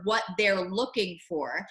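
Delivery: ordinary talk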